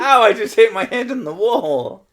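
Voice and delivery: high, loud laughing ow; laughing pain. Real, not acted. Laughing after hitting his head, high and loud. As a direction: laugh off the bump. Hurts himself.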